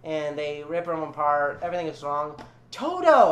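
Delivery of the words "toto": high, loud exclamation; startled, high-pitched cry; sudden remembering. Real, not acted. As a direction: blurt it out on remembering. Suddenly remembers forgotten Toto, calls out.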